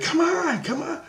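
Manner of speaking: put-on high, soft "come on" to shy kids; high soft coaxing. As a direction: coax them in, high and gentle. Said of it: "and I'm like".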